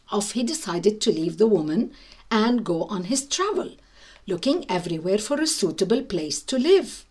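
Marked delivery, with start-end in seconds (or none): none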